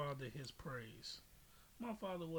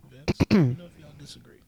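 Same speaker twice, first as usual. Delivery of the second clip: loud call across the room to Vince; 21 dB louder than usual. Real, not acted. Calling out loud to audience member Vince. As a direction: shout it out so Vince hears.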